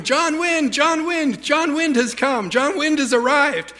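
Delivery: high-pitched excited crowd voice; excited high chant, hammed up; cheering villagers. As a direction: imitate villagers hailing a hero. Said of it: "they're all going".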